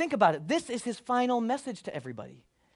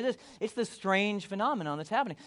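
ordinary talk

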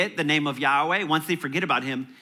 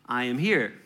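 ordinary delivery

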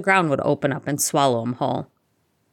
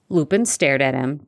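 usual speaking voice

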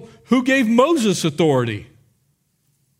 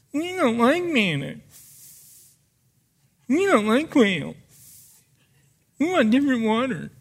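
whiny complaining, a touch softer; whining voice; 3 semitones higher, 3 dB quieter than usual. Acted. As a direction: whine about the food like a sulky crowd. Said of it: "I'm using my whining voice".

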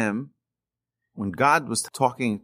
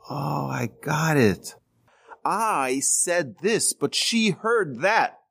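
slow, drawn-out aha moment; higher, slowly spelled-out revelation; dawning realisation; 4 semitones higher, much slower than usual. Retold or imitated. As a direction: stretch each word as it clicks. Acts out sudden realisation from book.

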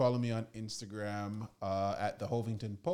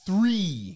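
three punched higher and louder after a pause; dramatic reveal; 9 semitones higher, 9 dB louder than usual. Real, not acted. Giving the season a score.

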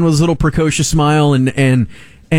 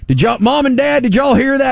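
higher, drawling Southern Santa imitation; imitated Southern US accent for Santa; 9 semitones higher than usual. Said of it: "he's got this big Southern drawl".

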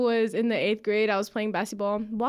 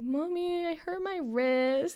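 put-on higher-pitched, drawn-out little kid whine; whiny kid voice, stretched out; hurt little kid; 7 semitones higher, slower than usual. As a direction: imitate a child crying out to mommy, slowly. Child telling mom of hurt wrist.

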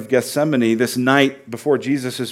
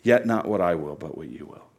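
hushed surrender; 10 semitones lower, 6 dB quieter than usual. Acted. Jesus yielding to the Father, soft surrender.

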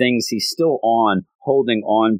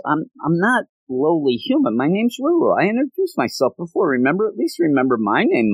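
mock-indignant, much higher pitched; indignant retort; 8 semitones higher than usual. Ruru correcting Magu-chan for calling her lowly, indignant. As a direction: protest in a high put-on girl's voice.

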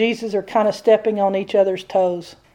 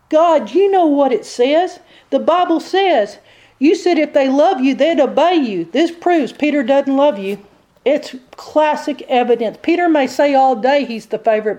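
raised, insistent accuser's voice; accusing devil; 4 semitones higher than usual. Acted. Devil quotes the Bible to God, accusing. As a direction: plead the prosecution's case as the devil. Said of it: "The devil presents the evidence".

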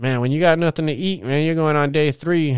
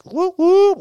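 high, loud celebratory whoop whoop; excited whoop; 6 dB louder than usual. Real, not acted. Hyped cheer for the UFC Strike crowd, whooping. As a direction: hoot it out like a victory cheer.

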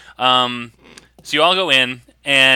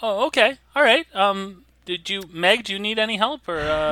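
high, softer shop owner; shopkeeper character; 8 semitones higher, 4 dB quieter than usual. Acted. Shop owner asks daughter about help, shopkeeper voice. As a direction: pitch up and offer help tentatively.

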